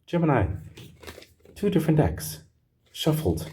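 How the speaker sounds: high amazed exclamation; stunned by the coincidence. Real, not acted. Reader telling Gemini about the decks, high amazement.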